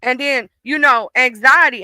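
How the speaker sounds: usual speaking voice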